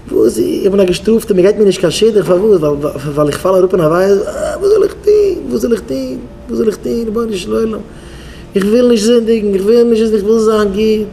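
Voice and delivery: acted wailing prayer, high-pitched; tearful pleading. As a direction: plead to God as if weeping. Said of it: "ער וויינט".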